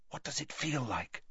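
hushed, curious whisper to a friend. Acted. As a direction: ask under your breath. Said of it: "whispered Hermione".

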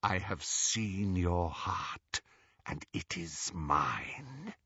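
very slow, soft, low voice; sinister hiss. A narrator's reading. Horcrux speaking to Ron from the locket, sinister hiss. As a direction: draw it out in a menacing hush. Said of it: "a voice hissed from out of the Horcrux".

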